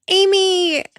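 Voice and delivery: gasp, then a high squeal of the name; real high-pitched delight; excited gasp. Real, not acted. Streamer spots a favorite nominee, excited gasp.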